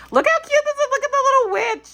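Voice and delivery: very high, loud squeal of delight; excited squeal. Real, not acted. Opening a friend's cards, she squeals at the witch. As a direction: gush like a thrilled fan. Gushing over Halloween cards.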